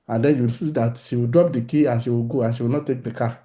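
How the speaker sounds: ordinary talk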